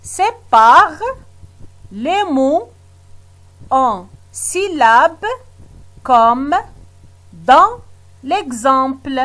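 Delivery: slow word-by-word reading with pauses; reading out instructions. Shown as a demonstration. Teacher reads the exercise instruction to pupils, word by word. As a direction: read it like a dictation, pausing between words. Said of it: "Je relis la consigne".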